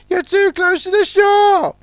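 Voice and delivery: high urgent warning call; yelling. Acted. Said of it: "yelled Ten Cents".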